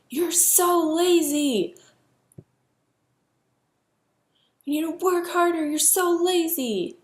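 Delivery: high, loud, slow furious yell; screaming at him. Retold or imitated. Mother screaming at her son in the canoe, high furious yell. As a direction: scream it down at a lazy kid. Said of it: "I just started screaming at him".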